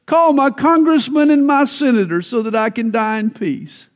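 dying pastor crying out. Retold or imitated. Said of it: "He kept crying out to the nurse".

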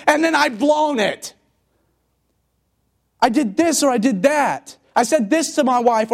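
high, loud, urgent preaching; fiery preaching. Acted. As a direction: preach the believer's guilt at full force. Preacher voicing guilty believer.

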